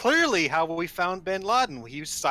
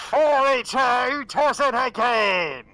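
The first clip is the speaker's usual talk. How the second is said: slow, loud toy catchphrase; like a cheap talking doll speaker; tinny talking doll; 7 semitones higher, 5 dB louder, slower than usual. Retold or imitated. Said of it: "eighties talking dolls with the really tinny speakers".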